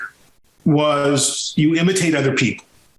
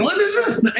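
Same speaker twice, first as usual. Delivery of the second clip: incredulous, pitched way up; skeptical scoff. Retold or imitated. Reacting to Girard's book with a high skeptical scoff. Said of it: "I was so skeptical".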